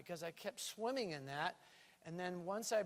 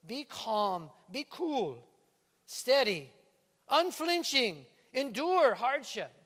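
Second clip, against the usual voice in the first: Jamaican lilt on the scripture, louder at the end; Jamaican accent; 3 semitones higher, 8 dB louder, slower than usual. Acted. Preacher slipping into a Jamaican accent. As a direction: read the verse with a Jamaican accent. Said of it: "I can't get out of my Jamaican accent".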